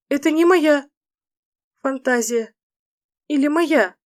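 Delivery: high wondering female voice; heroine voice. Acted. Фэй, женский голос.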